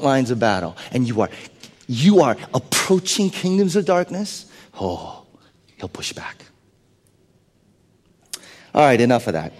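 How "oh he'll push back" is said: soft, unvoiced menace; ominous whisper. Preacher warning that darkness will push back in a whisper. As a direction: breathe it like a threat.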